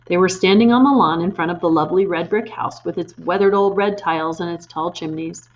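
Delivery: usual speaking voice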